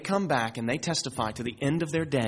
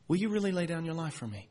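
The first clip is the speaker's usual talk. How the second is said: soft probing question; 2 semitones higher, 7 dB quieter, faster than usual. Acted. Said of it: "his question that he asked to Peter".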